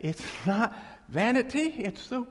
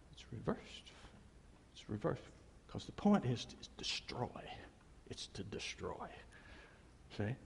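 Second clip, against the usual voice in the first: hushed whisper; 8 semitones lower, 15 dB quieter than usual. Real, not acted. Grave point on TV shows.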